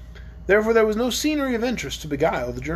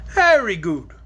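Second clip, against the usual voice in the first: cheerful; 5 dB louder, slower than usual. Acted. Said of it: "returned the dragon cheerfully".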